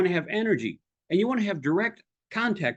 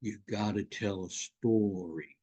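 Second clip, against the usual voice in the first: low, slow, softer parroting; mock droning advice; 8 semitones lower, 7 dB quieter, slower than usual. Coach mimicking what people say, mock droning. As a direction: drone it out as tired received wisdom. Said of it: "people say".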